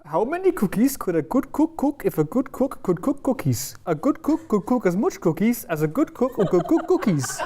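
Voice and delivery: bad Welsh accent that sounds Indian; attempted Welsh accent. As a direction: try Welsh and slip towards Indian. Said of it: "That is the worst accent for me".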